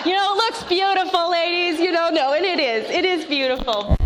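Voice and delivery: high laughing words, voice squeezed up; laughing through it at her own naivety. Real, not acted. Laughing while telling ladies the marriage looked beautiful.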